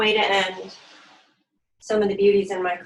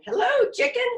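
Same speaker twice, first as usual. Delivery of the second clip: cheery duck greeting. Acted. Duck waving to Sheep from the bike, very high. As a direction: squeal out the greeting like a proud duck.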